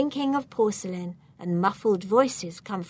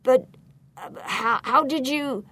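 halting, stammered question; stuttering; 4 semitones higher than usual. A narrator's reading. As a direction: stumble over the words, lost for an answer. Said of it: "stutters Renee".

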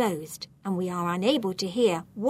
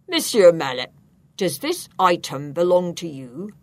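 low, loud official voice; stern policeman; 3 semitones lower, 6 dB louder than usual. A narrator's reading. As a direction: question him like a stern policeman. Policeman confronts the suspect.

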